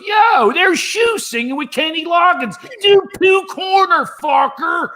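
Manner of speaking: high, rowdy yelled heckle; drunk heckler. Retold or imitated. Drunk friends heckling comic on stage, rowdy high yell. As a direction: bellow it from the cheap seats.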